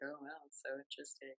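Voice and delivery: flat, quiet mock interest; bored sarcasm under the breath; sarcastic, bored. [name] mocking the critics.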